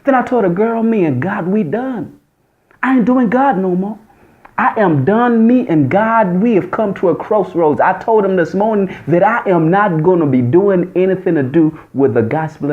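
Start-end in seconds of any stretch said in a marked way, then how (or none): 0.5-7.8 higher, sassy and emphatic; a raised, defiant declaration to a friend. Retold or imitated. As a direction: tell her you're done with God. Defiant retort to the girl.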